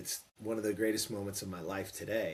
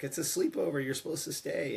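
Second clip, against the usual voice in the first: higher-pitched mom's protest; retold protesting mom; 7 semitones higher, 4 dB louder than usual. Mom on the phone to her boy, imitated protesting voice.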